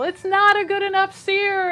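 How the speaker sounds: high, loud imitation of a screaming chef; Gordon Ramsay yelling. TV chef screaming at a cook over a bad sear, loud mock yell. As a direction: bark it like an angry TV chef. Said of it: "Gordon Ramsay screamed at people".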